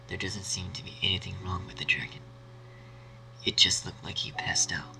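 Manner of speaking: usual speaking voice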